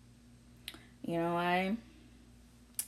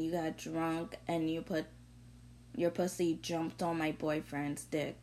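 ordinary talk

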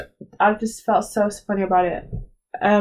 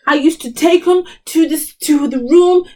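mocking [name]; 6 semitones higher, 4 dB louder than usual. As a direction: parody her boastful confession. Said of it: "barely able to speak English".

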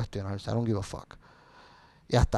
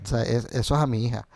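usual speaking voice

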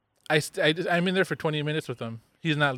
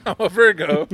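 loud, slow, high mimicry; mocking echo; 3 semitones higher, 8 dB louder than usual. Podcast host echoing his friend's Virgo remark, slow loud mockery. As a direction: parrot it back drawn out and louder.

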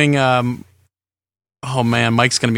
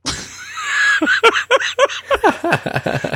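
high laughing shout that falls away. Real, not acted. Host cracking up at his own goofy call, shouting a laugh. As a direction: whoop with laughter.

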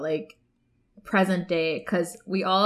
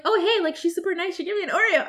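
higher, lightly mocked enthusiasm; chirpy quoted classmates; 10 semitones higher, 3 dB louder, faster than usual. Classmates chatting about the Oreo girl, imitated chirpily. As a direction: imitate impressed classmates in a raised pitch. Voicing classmates meeting her over Oreos.

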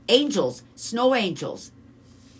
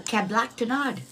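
backwards speech; 4 semitones higher than usual. Shown as a demonstration. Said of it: "do that backwards".

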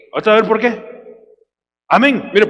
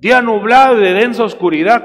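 preaching at full force; 5 semitones higher, 5 dB louder than usual. Acted. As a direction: thunder the verse to the church. Reading scripture at full force. (predicación lenta y fuerte)

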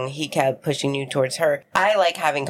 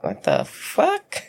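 retold disbelief; 7 semitones lower, much slower than usual. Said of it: "And I'm like".

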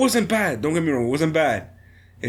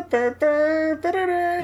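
trombone imitation; 14 semitones higher, much slower than usual.

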